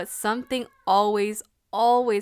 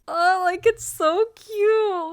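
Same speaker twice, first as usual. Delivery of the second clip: high, slow, louder gushing; fangirl squeal; 10 semitones higher, 8 dB louder, much slower than usual. Real, not acted. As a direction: melt over how cute it is. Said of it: "I was dying".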